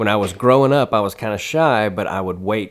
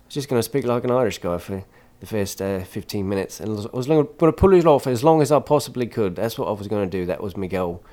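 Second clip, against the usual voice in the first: lilting Irish guy accent; casual Irish brogue. Shown as a demonstration. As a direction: slip into a convincing Irish accent. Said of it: "That was good. I, I really felt like you were getting ready to sell sheep pelts".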